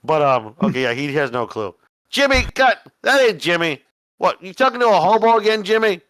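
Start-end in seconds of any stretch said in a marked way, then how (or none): 2.1-6.0 loud, high cop yelling at his partner; gruff cop shout. Acted. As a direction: bark it like an angry patrolman.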